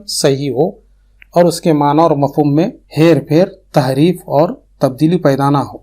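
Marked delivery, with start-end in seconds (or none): none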